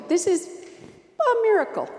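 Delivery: miracle rising high and loud; excited proclamation. Real, not acted. Preacher proclaiming the miracle to the congregation, excited. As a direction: announce the wonder with lift.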